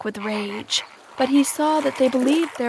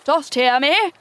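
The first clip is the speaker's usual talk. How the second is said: high, loud questioning call; raised voice with quiver; 5 semitones higher, 5 dB louder than usual. A narrator's reading. Hook asks the spirit to answer, raised voice. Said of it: "Hook raised his voice".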